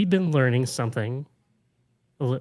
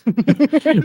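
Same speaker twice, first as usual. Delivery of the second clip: high giggle; 12 semitones higher, 7 dB louder than usual. Real, not acted. Excited giggle before spooky news.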